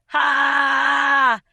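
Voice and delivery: one huge barking laugh, high and loud; shouted ha. Acted. A janitor's booming laugh echoes off the lockers. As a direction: blast a single laugh down the hallway.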